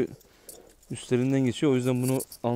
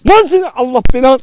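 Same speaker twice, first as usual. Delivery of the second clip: angry yell; 12 semitones higher, 16 dB louder than usual. Real, not acted. Yelling at the wild dog.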